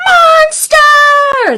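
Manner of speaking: high, loud cheer. Acted. Little monsters cheer for their creation, high and loud. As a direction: cheer it together. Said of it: "They all cheered together".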